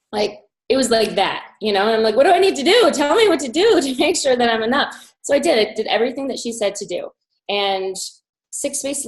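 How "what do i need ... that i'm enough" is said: pitched-up, louder pleading; half-laughing eager plea. Retold or imitated. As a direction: beg to be told what to do. Pleading to be told what to do.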